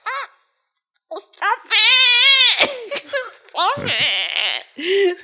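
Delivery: very high, mock-tearful squeal; melting squeal. Mum melting at her son's compliment, squealing. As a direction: whine it as if overcome with cuteness.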